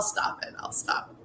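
breathless whispered self-scolding; whispered aside. Real, not acted. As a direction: whisper it to yourself, reining in. Reins herself in after joke.

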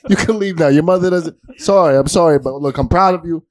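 high proud father calling out. Acted. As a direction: call the wife downstairs. Proud dad calling wife downstairs.

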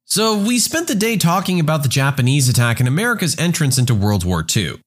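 ordinary delivery

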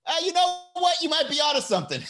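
high, mock-agreeing voice; mock reply. Comic mock agreement to the accuser. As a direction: play along in a high put-on voice. Acting out a judged person replying.

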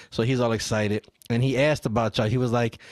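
usual speaking voice

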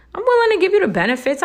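high, fast, eager mocking voice; hammed-up eager promise; mock eager girl. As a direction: rattle it off like a naive lover. Mocking a naive lover.

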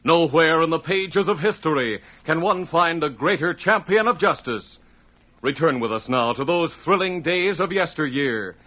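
rising, stirring radio intro; old-time radio announcer opening the show; dramatic announcer. Acted. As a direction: announce it grandly, building excitement.

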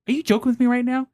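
retold disbelief. Retold shock at guacamole news.

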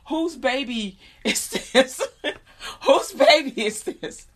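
high, drawn-out question through laughter; slow, incredulous asking while cracking up; laugh-broken retold question; laughing retelling.

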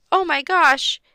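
high, loud startled shout; a boy yelling in fright at a skeleton. A narrator's reading. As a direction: jump back and yell. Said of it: "Dink yelled".

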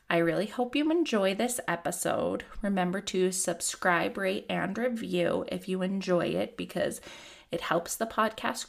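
soft, measured read of a disclaimer and plugs; podcast intro read. Acted. Host reading the disclaimer and her Instagram handle, calm and measured.

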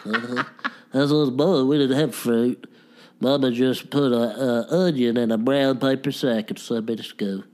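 lightly put-on odd old-uncle character; a hint of a weird uncle voice; Uncle Cecil. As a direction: play a strange, sweet country uncle. Host voicing Uncle Cecil character.

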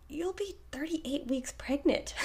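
slow, hushed half-whisper; incredulous reply. Retold or imitated. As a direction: whisper it slowly in mock disbelief. Retold disbelieving reply to cousin.

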